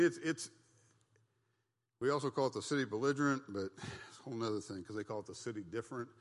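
normal talking voice

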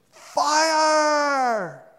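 high, loud cry of Fire; a sudden warning shout; shouted alarm. Pretend. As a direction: yell it like the room's burning. Elder spots smoke in church.

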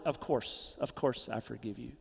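low, soft, measured acceptance; gentle forgiving. Acted. Wronged person answering an apology, soft and forgiving. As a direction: drop low and quiet to grant forgiveness. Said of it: "your response is".

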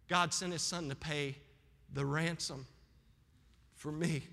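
softly bringing it home to the congregation; hushed preaching. Real, not acted.

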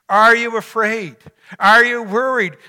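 preacher pressing the congregation; pressing questions. Real, not acted. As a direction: press each question home.

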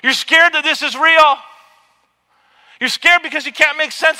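loud, insistent preaching as Jesus; loud voice of Jesus naming the fear; Jesus confronting. Pretend. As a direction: hammer each you're scared. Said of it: "He says".

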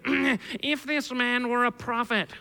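drawn-out, put-on Pharisee. Preacher quoting the Pharisee who saw it, put-on Pharisee voice. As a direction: play a pompous Pharisee, stretching the words. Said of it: "the Pharisee voice that I have".

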